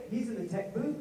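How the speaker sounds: ordinary talk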